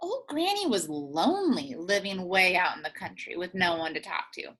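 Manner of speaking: plain reading voice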